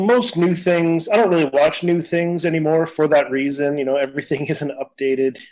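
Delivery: normal talking voice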